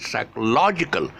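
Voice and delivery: "psychological" with LOG pushed up high; over-stress "log" in psychological; teacher exaggerating psycho-LOG-ical; extra shifted stress. Shown as a demonstration. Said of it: "stressing it further than what I should".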